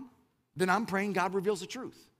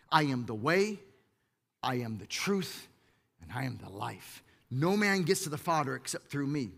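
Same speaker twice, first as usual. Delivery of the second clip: solemn scripture quote; 6 semitones lower, 3 dB quieter than usual. Acted. Quoting Jesus solemnly.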